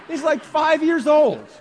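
high, loud and slow, marveling; amazed exclaim. Real, not acted. Pastor marvels to congregation at his five-year-old, high and loud. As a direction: stretch it out in real wonder.

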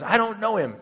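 high-pitched emphatic disavowal; sworn denial. Acted. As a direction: act out swearing you don't know him, pitch jumping up. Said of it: "And he swears".

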